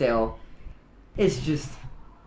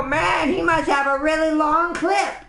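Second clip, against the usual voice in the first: high, animated grunt voice; imitating a high-pitched cartoon grunt; 9 semitones higher than usual. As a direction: exclaim as an amazed little game grunt. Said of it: "the grunts go".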